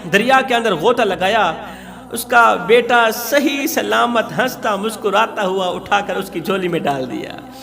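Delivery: usual speaking voice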